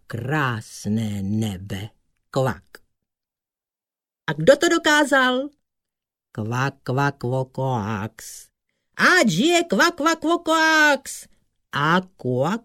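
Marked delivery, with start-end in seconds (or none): 4.3-5.5 high, fast, excited call; rousing question. A narrator's reading. Storyteller asks the sprites who achieved it, rousing. 9.0-11.3 very high, loud hurrah; jubilant cheer. A narrator's reading. Water sprites hail the hero of the tale, jubilant.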